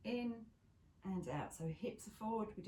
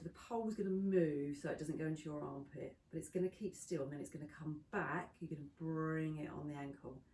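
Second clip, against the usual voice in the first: ordinary talk, nothing marked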